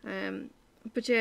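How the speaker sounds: normal talking voice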